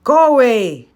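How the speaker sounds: put-on old hag croak, high and loud. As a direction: croak it out like a cornered old hig. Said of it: "she croaked".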